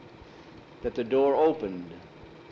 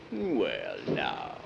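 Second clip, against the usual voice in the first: drawn-out low villain voice, softer; gloating villain; 13 semitones lower, 5 dB quieter than usual. Acted. Skull master eyeing his captives, gloating. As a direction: let it sink low, gloating.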